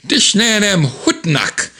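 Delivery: high, slow nonsense words; garbled gibberish. Acted. Kevin trying to explain what's going on, high gibberish. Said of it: "And he says".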